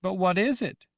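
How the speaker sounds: put-on high, loud young girl voice; childlike Rachel. Girl Rachel asking the woman, high and loud. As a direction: sound like a scared little girl.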